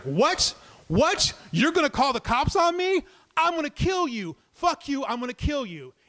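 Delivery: high, rising furious put-on voice; mock threats. Ranting man threatening the woman who calls the cops, high fury. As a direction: escalate into raving threats.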